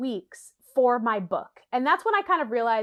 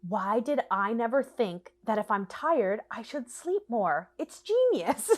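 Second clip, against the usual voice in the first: sarcastic awe; 3 dB quieter, slower than usual. Acted. Reader pretending the sleep tips are a revelation, sarcastic awe. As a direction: marvel slowly at painfully obvious advice.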